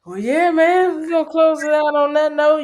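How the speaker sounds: talking through a held-in yawn; sleepy, stifling a yawn; fighting back a yawn. Real, not acted. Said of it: "I'm fighting back yawns".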